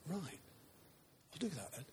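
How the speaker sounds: small, very soft reply; meek compliance. Acted. Believer answering God's correction, meek and hushed. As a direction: give in quietly.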